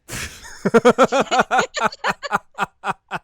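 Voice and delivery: loud, high burst of laughter; big laughing fit. Real, not acted. Host cracking up at the [name] from Blink joke, big laughing fit.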